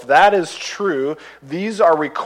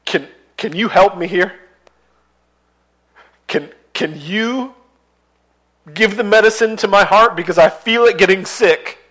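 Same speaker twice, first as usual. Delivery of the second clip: higher, halting plea for help; pleading prayer; 6 semitones higher, 5 dB louder than usual. Retold or imitated. Believer begging God to heal a sick heart, higher and halting. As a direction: ask God for help haltingly, almost breaking. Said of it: "I have to come to you and say".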